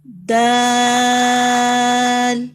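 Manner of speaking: دال very slow and loud, the long aa stretched; drawn-out letter. Shown as a demonstration. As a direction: stretch دال slowly at full voice. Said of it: "اونچی آواز سے پڑھیں".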